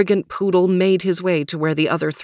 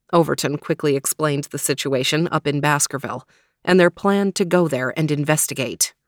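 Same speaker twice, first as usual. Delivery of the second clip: usual speaking voice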